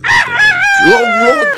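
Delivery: very high loud falsetto cry; high shrieking reaction; falsetto squeal. Real, not acted.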